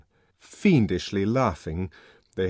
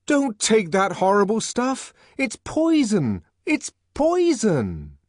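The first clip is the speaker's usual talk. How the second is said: impassioned plea; 7 semitones higher, 5 dB louder than usual. A narrator's reading. Savage pleading with the crowd, impassioned. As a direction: warn them with all your heart.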